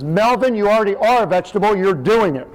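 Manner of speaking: high-pitched bossy wife, imitated; exasperated mother. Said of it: "My mother says".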